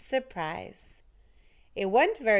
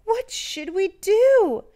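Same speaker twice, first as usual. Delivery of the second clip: high, loud, slow worried cry; anxious children's cry; 10 semitones higher, 7 dB louder than usual. A narrator's reading. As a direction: cry out in alarm like small children. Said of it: "The children cried out".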